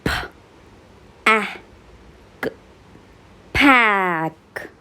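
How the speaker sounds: k, a, p, then blended into cap; sounding out words. Shown as a demonstration. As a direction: say each letter sound with pauses, then blend. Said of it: "Let's practice and blend the sounds".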